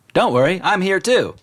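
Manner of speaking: high loud call. Acted. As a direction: call out cheerfully. New voice calls out.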